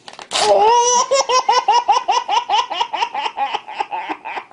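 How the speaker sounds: long, high crying laugh; high wheezing laugh. Real, not acted. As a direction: laugh until it squeaks. Long laugh after pitch.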